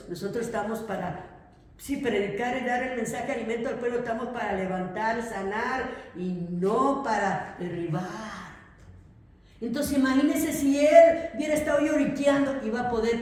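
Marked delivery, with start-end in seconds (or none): none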